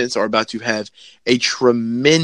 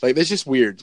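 normal talking voice

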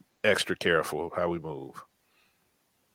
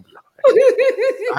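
very high, loud squeaky reassurance through a laugh; hammed-up virtual back pat in a squeaky voice; mock comforting squeak; 13 dB louder, slower than usual. Shown as a demonstration. Co-host jokingly comforting the crying host, squeaky and laughing. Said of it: "I could do a virtual back pat".

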